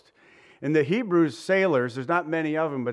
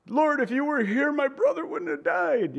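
high, grieving plea to the Lord; pleading Martha; 11 semitones higher than usual. Acted. Sister reproaching the Lord for her brother, high and pleading.

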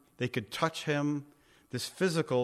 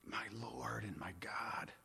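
hushed, slow, broken whisper of devotion; awed whisper; 5 semitones lower, 17 dB quieter than usual. Retold or imitated. Preacher voicing Thomas on his knees, awed whisper. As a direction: breathe it out, overcome, barely voiced. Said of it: "and he's crying".

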